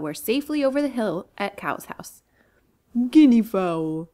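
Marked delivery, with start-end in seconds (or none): 3.1-4.1 slow loud mooing cow; grateful moo. Acted. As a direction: draw the name out like a cow. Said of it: "mooed Cow gratefully".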